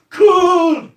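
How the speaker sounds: full-voiced commentator's yell, pitched high; high, drawn-out shout. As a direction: belt it out long and loud like a match commentator. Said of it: "at the grand final this year shouting".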